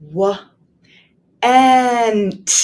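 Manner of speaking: W- and, split apart; teacher sounding out W and; sounding out the word. Shown as a demonstration. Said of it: "Did you hear the quick one at the end?".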